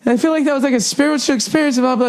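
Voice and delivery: high, dreamy mock-woman voice; high-pitched ditzy mock rapture; airy spiritual lady. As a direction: gush like it was a spiritual awakening. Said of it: "this woman gets up like".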